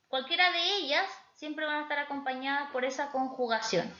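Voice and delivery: usual speaking voice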